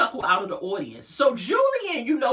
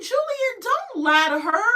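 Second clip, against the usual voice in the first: much higher, sassy call-out; calling him out; 10 semitones higher than usual. Retold or imitated. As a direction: squeal it at him in disbelief. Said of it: "And I was like".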